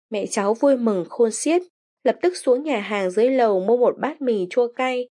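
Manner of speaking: ordinary delivery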